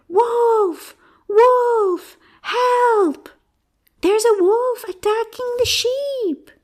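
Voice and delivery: very high-pitched cry for help; loud shout. Acted. Shepherd boy tricking villagers below, loud shout. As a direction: shout the alarm, high and drawn out. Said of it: "shouted as loudly as he could".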